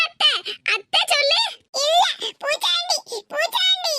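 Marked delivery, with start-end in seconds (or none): 1.8-3.9 put-on high little-child voice, insisting; a small child repeating it stubbornly; toddler voice. Toddler grandson insisting his aunt is a bogeyman, high childish voice. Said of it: "பிஞ்சு மனசுல".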